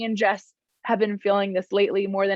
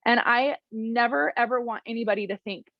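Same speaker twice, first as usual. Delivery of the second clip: ordinary delivery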